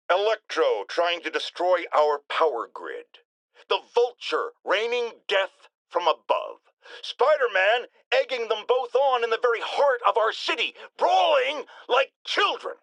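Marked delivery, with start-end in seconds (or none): none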